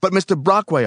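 high, loud protest; pleading cry. A narrator's reading. Helper appealing to raging boss, crying out. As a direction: cry out to get through to him. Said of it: "I cried".

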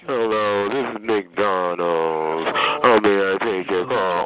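mocking phone voice. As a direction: drag it out low like a dim call-center worker. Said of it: "I wouldn't even allow you to answer a phone".

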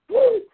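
high wrestling-promo woo, yelped out; Ric Flair woo. Retold or imitated. Host imitating Ric Flair in a wrestling promo, piercing high woo. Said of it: "a Ric Flair".

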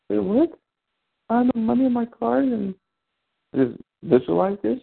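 high, stunned disbelief; flabbergasted. Retold or imitated. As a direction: squeak it out like you can't believe it worked. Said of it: "I was flabbergasted".

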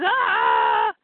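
acted drawn-out yell of a struck guard; guard's cry. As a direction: yell like a guard taking a hit. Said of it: "the guard goes".

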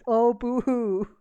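slow mock boo-hoo sob; drawn-out pretend crying; fake crying. Mocking a scared friend.

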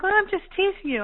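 imitating a friend's high-pitched excuse; breezy excuse. Teasing friend defends the joke, high breezy excuse.

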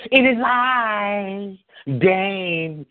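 slow, stately "It is I, Dane"; a mock-regal entrance, stretched slow; grand slow Dane impression. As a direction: announce yourself like royalty. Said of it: "repeats Dane bit".

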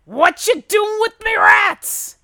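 loud, high-pitched gruff dwarf shout. Acted. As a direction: bellow like an outraged gruff dwarf. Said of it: "gruff voice go".

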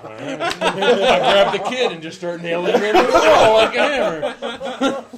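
words breaking up with laughter; slow, laugh-filled delivery. Real, not acted. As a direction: say it through helpless laughter. Laughing at own absurd suggestion.

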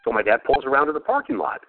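ordinary talk, nothing marked

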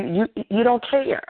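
ordinary talk

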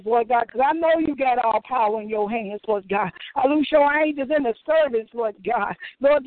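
usual speaking voice

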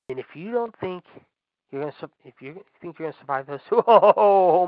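usual speaking voice

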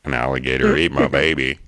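loud, badly faked American accent; attempted American accent. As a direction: belt out a clumsy American accent. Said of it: "I've been practicing that all morning".

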